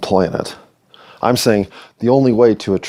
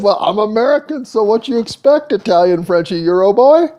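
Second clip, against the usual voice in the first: much higher, hammed-up goofy voice; mock brash American; 12 semitones higher than usual. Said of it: "I said".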